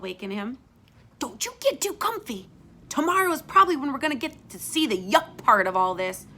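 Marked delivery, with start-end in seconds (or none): 1.2-6.3 bossy big sister. Acted. Florida warns her brother.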